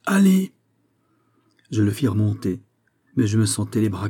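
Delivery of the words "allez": crié très haut et plus fort; un ordre hurlé vers le haut du puits; cri. Acted. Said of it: "cria à nouveau". (le valet crie de remonter, cri aigu et fort)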